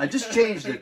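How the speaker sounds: parroting the customer's excuse, mock innocent; imitating a customer. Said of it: "every time someone".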